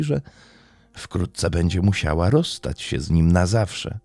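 plain reading voice

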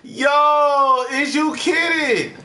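high, slow stretched yell; real exasperated outcry; disbelieving shout. Real, not acted. Disbelief at lost save.

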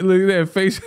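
ordinary delivery

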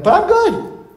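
squeaky high mock of 'I'm good'; parroting complacent people in a high silly voice. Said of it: "they're like".

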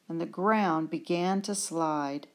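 usual speaking voice

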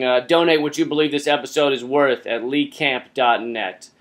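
ordinary delivery

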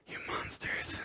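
whispered mock accusation. Imagined company response, whispered in mock horror. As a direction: breathe it like a wounded villain. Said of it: "Smashburger's response".

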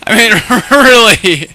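high, loud laughing disbelief; real laughter. Real, not acted. Reacting to a bizarre in-game moment, laughing.

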